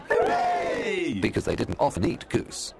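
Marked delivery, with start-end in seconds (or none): 0.1-1.2 a burst of joy from the whole family; shouted hooray, way up high; children's cheer. A narrator's reading. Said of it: "all the children in the family shouted".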